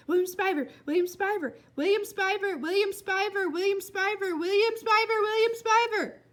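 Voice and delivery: building from high call to loud shout. Acted. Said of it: "louder and louder".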